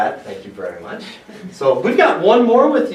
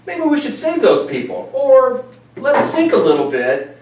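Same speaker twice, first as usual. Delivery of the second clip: much higher, mock-naive voice of people who wait; mock bystander voice; 8 semitones higher than usual. Host mocks a healthcare system that waits, in a high mock-naive voice. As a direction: play the clueless crew shrugging at the sinking ship.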